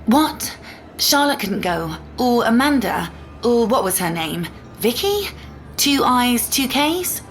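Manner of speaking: mock-questioning, pitch leaping up on the names; sarcastic. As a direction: needle the ex with fake innocent questions. Sarcastic jab at ex's girlfriends.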